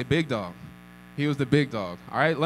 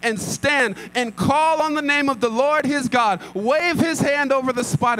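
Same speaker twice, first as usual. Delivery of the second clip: higher and louder, indignant grumble; angry complaint; 8 semitones higher, 5 dB louder than usual. Acted. Naaman fuming that the prophet never came out, loud and indignant. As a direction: let his wounded pride boil over. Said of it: "Naaman was angry".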